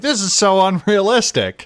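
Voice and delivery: loud, high-pitched protest; mock outraged complaint. Imagined players objecting to plot.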